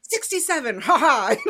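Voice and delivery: gleeful boast with a loud laugh; mock gloating. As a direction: imitate a triumphant score-keeper, laughing. Imitating Hoggle boasting his kill count.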